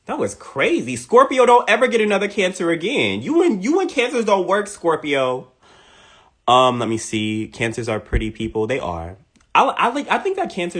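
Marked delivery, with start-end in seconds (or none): 0.9-5.5 emphatic warning. Real, not acted. Warning Scorpio directly.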